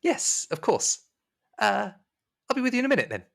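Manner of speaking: high falsetto servant voice; high, courteous maid; obliging maid. Acted. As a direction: politely excuse yourself as a maid. Game master plays the maid.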